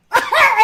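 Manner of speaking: sudden loud high laugh; a real, loud laugh at the story; burst of laughter. Real, not acted. Laughing hard at breaking character with Joel, loud laugh.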